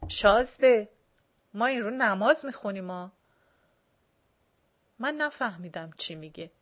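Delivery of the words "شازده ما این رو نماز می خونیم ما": sharp angry outburst, louder. A narrator's reading. Dashakol angrily scolding the guest, loud angry. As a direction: snap at the prince in anger. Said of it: "داشاکل با عصبانیت گفت".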